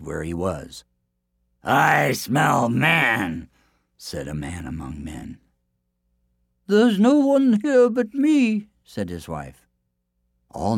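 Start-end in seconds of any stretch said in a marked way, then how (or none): 1.6-3.5 very slow, booming giant voice; slow sniffing ogre menace. Acted. Giant smells the hiding man. 6.7-8.7 much higher, slow, innocent wife voice; high falsetto denial; wife covering. Acted. Wife hiding the boastful man.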